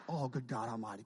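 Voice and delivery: ordinary talk, nothing marked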